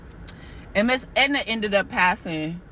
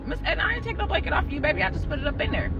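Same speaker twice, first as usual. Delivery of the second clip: soothing caregiver; 6 semitones higher, much faster than usual. Retold or imitated. Caregiver soothing confused elderly patient.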